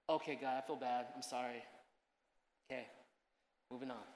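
low, soft, hurried brush-off; dismissive prayer. Pretend. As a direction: mumble an apology just to get it over with. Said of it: "the Christianese thing to do".

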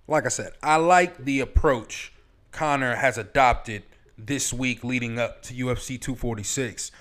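ordinary talk

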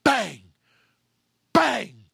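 sudden explosive shout imitating gunfire; loud, sharply falling bursts; very loud gunshot. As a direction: fire each word out like a shot. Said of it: "suddenly a very loud report of the gunfire".

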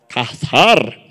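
"Cazar" drawn out with a forceful breathy H; Hebrew-style pronunciation. Shown as a demonstration. Preacher confirms the answer, "Cazar" with long H and stressed ending. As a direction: say "Cazar" as cah-HAR, loud on the ending. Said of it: "con una H en medio".

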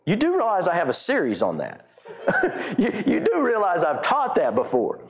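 high, laughing "oh, really?" with a teasing edge; a laughing, teasing pastor correcting a fan; amused mock disbelief. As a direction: play it as a chuckling, wry retort to an excited listener. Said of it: "I'm like".

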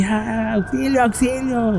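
mock distress call, high but soft; grito de auxilio imitado. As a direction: imitate a drawn-out, high-pitched plea over the radio. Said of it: "empieza el chilladero en el radio". (imitando una llamada de auxilio angustiada)

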